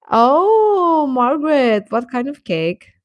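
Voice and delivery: ordinary talk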